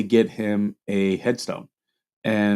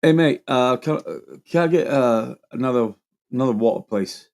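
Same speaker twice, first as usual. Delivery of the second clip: put-on British accent, casual and halting; mock British accent; 3 semitones higher than usual. Visitor showing off to a UK friend, mock British accent. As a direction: imitate a Brit asking politely, in a British accent. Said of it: "Okay, try to use our accent".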